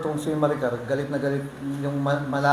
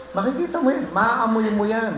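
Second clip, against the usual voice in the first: emphatic; 7 semitones higher than usual. Real, not acted. Preacher stressing a point.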